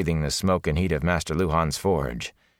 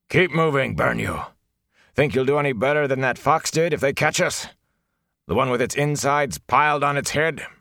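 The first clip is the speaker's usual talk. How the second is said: gruff, impatient woodsman shouting orders; harsh urging; 7 semitones higher than usual. Acted. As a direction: bark at them to keep moving. Elias driving them on.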